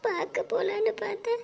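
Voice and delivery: high, sing-song mourning; keen through the loss, voice held high; crying lament. Acted. Younger sister mourning an elder who left unseen, wailing. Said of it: "அழுகாதீங்கடி".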